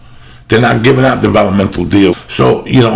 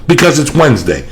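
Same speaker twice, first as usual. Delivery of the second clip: higher, louder pitchman plugging sponsors and shows; hyped ad read; 5 semitones higher, 3 dB louder than usual. Acted. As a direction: sell it like a radio ad. Reads a sponsor ad and show plugs.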